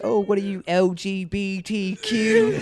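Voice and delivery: high, loud mock-British taunt; British accent. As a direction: jeer in a hammed-up Cockney accent. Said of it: "she was in a British accent".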